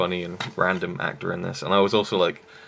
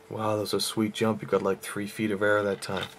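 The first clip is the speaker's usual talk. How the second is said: lightly voiced film quote, a touch softer; quoting a movie character; 4 dB quieter than usual. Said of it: "You can just quote it".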